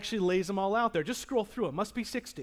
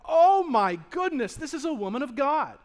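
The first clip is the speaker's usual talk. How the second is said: amazed onlookers; 4 semitones higher, 7 dB louder than usual. Retold or imitated. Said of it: "they look at her and they go".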